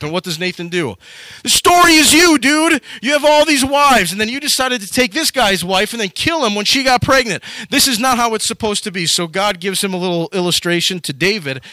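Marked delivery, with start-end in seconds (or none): 1.4-9.1 loud rebuke. Retold or imitated. Nathan confronting David.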